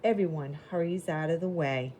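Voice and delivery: lively picture-book reading voice; reading to children. Acted. Teacher reading a storybook to the class, lively. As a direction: read it bright and clear for little kids.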